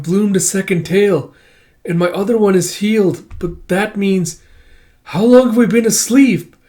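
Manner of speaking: high voice building to a loud, shocked question; alarmed young character. Retold or imitated. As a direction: act out a youngster's dawning realization. Voicing Kippa's growing alarm.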